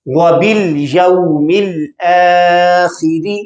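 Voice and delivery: slow recited verse, vowels drawn out; Quranic recitation. Shown as a demonstration. Teacher recites the verse for students. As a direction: recite वबिल यौमिल आखिरी slowly. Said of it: "अब यहां देखें".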